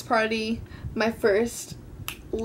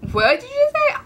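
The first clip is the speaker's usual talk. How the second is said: sharp, much higher, louder disbelief; a dad who can't believe his ears; mock-stunned father asking; shocked dad; 5 dB louder than usual.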